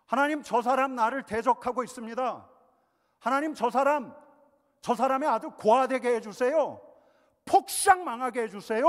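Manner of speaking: shouting, high and very loud; furious cursing prayer. Pretend. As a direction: cry out to God in rage. (하나님께 분노를 쏟아내며 외치듯)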